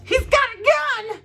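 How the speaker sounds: high, slow mock scream; imitating the woman yelling from the truck. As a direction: shriek it like the panicked woman in the truck. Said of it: "so one of them yells".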